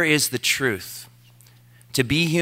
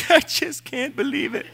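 high wailing whimper, laughing at himself; mock sobbing with a laugh; 14 semitones higher than usual. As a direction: play up a tearful wail, breaking into laughter. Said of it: "who heard me mourn".

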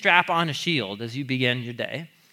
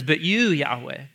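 slow, solemn reading of a psalm line; scripture reading. Acted. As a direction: read it slowly, with reverence. Reads psalm aloud slowly.

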